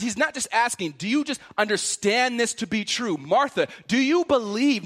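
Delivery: driving, insistent sermon delivery; impassioned preaching. Acted. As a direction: press each question harder on the listener. Preaches at full force.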